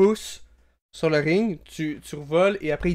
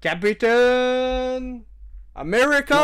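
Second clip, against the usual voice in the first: ring announcer; 8 semitones higher, much slower than usual. Acted. GM as announcer introducing the champion, booming. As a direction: stretch every word like a boxing MC.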